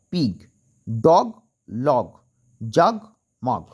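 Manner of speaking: ডগ and লগ drawn out slowly, one by one; slow dictation. Shown as a demonstration. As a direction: spell out ডগ, লগ slowly for the children. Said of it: "আমি আরেকবার বলে দিচ্ছি". (ধীরে ধীরে ডগ, লগ বলা)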